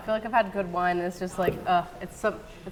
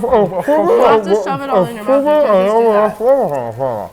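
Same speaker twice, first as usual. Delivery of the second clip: loud protest; 9 dB louder than usual. Real, not acted. Protesting cheating at challenge.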